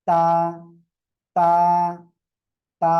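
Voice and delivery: তা with tongue tip at the tooth roots, open "aa"; teacher's light, thin তা; thin dental sound. Shown as a demonstration. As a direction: model তা cleanly, vowel slightly held. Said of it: "জিহ্বার আগা দুই দাঁতের গোড়ার".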